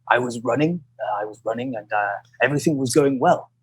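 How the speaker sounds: low, halting reminiscence; imitated old rival. Old British runner recalling the race, imitated low and halting.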